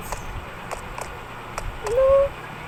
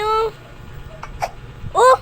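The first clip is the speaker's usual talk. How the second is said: usual speaking voice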